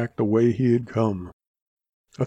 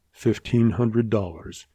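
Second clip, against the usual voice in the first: plain reading voice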